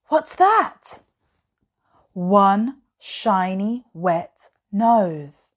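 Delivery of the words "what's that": sharp gasp, then a much higher, louder question; startled gasp. Acted. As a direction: gasp as if something moved in the dark. Child spots something in cave.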